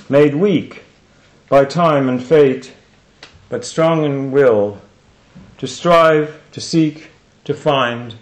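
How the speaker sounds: loud, deliberate verse in spaced phrases; poem recitation. Acted. Speaker repeating the poem's ending for the audience, declamatory recitation.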